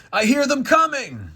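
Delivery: high, loud dramatic cry; God's warning call. Acted. God warns angels of attackers, alarmed call. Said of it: "And God says".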